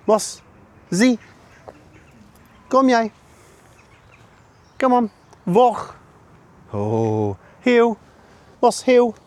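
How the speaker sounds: bright high-pitched call to the dog; high dog command. Real, not acted. Calling commands to his dog.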